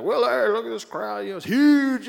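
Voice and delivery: a hint of a boastful politician greeting a guest; Donald Trump. Trump welcomes Willie aboard the RV, light impression.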